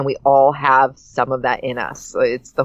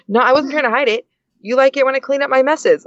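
higher, lightly voiced little girl; four-year-old daughter; 9 semitones higher than usual. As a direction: plead like a small child. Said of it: "She's four".

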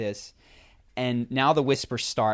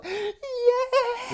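mock crowd cheer; 4 dB louder than usual.

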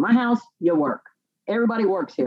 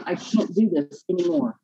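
usual speaking voice

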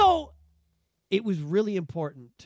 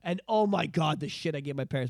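normal talking voice

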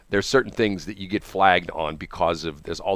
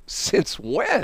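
incredulous; 13 semitones higher than usual. Retold or imitated. Incredulous retort to clerk.

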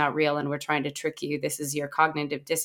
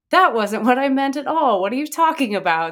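higher, louder mock-outraged protest; in-character indignant protest; 5 semitones higher, 5 dB louder than usual. Site owner staying in character about losing customers, mock-indignant. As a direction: play the affronted business owner, voice jumping up. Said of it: "I try and stay in character".

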